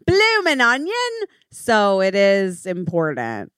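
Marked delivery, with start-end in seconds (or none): 0.1-1.1 very high put-on Aussie catchphrase; mock Australian accent. Co-host chimes in on the steakhouse, mock Australian accent. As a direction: squeal it as a fake Australian slogan.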